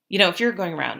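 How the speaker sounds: usual speaking voice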